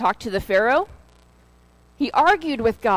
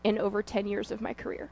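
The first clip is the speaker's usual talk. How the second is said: much softer, near hushed retelling; quiet, moved quote; 2 semitones lower, 10 dB quieter than usual. Retold or imitated. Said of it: "and she said".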